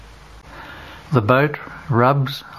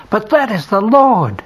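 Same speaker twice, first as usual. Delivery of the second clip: much higher, louder cry of recognition; excited shout; 9 semitones higher, 5 dB louder than usual. Acted. John cries out to Peter in the boat, excited. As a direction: burst out as it dawns on you. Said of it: "shouts John".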